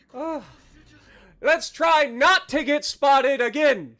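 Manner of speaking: ordinary talk, nothing marked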